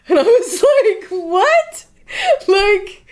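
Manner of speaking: high, loud, choked with emotion; laughing through tears of joy; overwhelmed laughing cry. Real, not acted. Overwhelmed by gift of paint.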